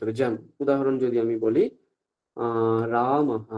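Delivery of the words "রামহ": রামহ drawn out slowly, ending in h plus the echoed vowel; voiced visarga echo. Shown as a demonstration. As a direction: stretch রামহ and breathe out a final "ha". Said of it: "হকারের সঙ্গে সেই স্বরবর্ণ যুক্ত করে উচ্চারণ". (বিসর্গের হ-কার উচ্চারণ)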